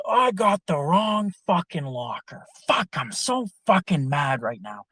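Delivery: retold yelling. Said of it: "Starts yelling at this fat lady".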